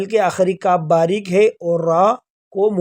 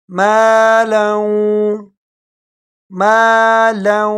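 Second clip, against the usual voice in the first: مالا drawn out slowly; tajweed مالا; slow recitation; much slower than usual. Shown as a demonstration. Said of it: "لام کو آگے واو کے ساتھ ملائیں گے".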